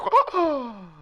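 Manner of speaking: high loud scandalised gasp; offended gasp. Acted. Puss gasping when called a pervert. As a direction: gasp as if deeply insulted.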